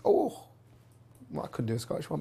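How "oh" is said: high-pitched imitated yelp; mock yelp. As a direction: yelp it up high like a startled man. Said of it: "and then I get a yelp".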